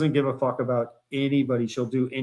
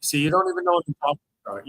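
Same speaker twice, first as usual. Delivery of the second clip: ordinary talk, nothing marked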